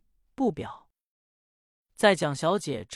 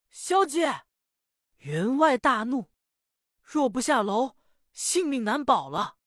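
put-on much higher, alarmed servant girl; frightened maid; 6 semitones higher than usual. Maid warns the young lady her life is in danger, higher and alarmed. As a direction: cry out a warning in alarm.

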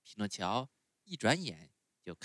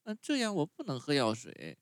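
put-on much higher, whiny little boy; puppet boy voice; 6 semitones higher, 4 dB louder than usual. A narrator's reading. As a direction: sulk like a spoiled child refusing medicine. Pinocchio voiced in story.

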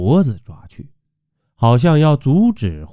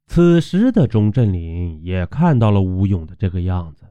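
usual speaking voice

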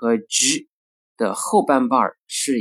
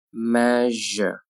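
"Measure" slowly with a held zh; slow careful "Measure"; drawn-out model. Shown as a demonstration. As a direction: stretch "Measure" out for learners. Teacher models word slowly, then normally.

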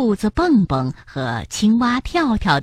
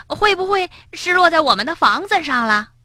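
high, eager follow-up question; pressing question; 11 semitones higher than usual. A narrator's reading. Frog asks Rabbit where the storks landed, pressing. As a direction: press for an answer, pitch well up. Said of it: "跳跳追问道".

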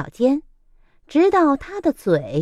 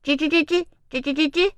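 轻声吱吱叫; 小松鼠吱吱; squirrel squeaks; 5 semitones higher, much faster than usual. Acted. (松鼠轻轻吱吱叫)

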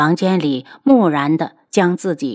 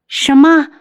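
loud, high-pitched startled cry; shocked; 9 semitones higher, 5 dB louder than usual. A narrator's reading. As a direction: burst out in utter disbelief. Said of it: "楚清瑶大惊失色". (大惊失色地高声惊问)

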